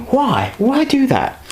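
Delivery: high-pitched mock questioning; imitated puzzled question, pitched right up; questioning viewer.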